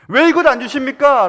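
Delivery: high and loud, demanding why; loud complaining cry. Acted. Preacher voicing a believer crying out to God, loud and high.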